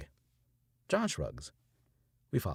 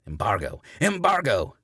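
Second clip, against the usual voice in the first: loud, climbing to much higher shout; screaming preacher; 5 semitones higher, 10 dB louder than usual. A narrator's reading. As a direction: storm off screaming it.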